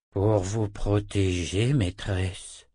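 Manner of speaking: slow talking-wolf voice; drawn-out, humble answer to a mistress; loup parlant soumis. Acted. (voix de loup, lente et soumise)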